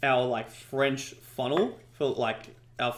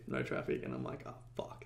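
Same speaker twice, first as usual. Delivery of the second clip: sinking to a near-whisper; retold dejection; 13 semitones lower, 12 dB quieter than usual. Affiliate retelling another day without traffic, quietly dejected. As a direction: let it die away into a defeated hush.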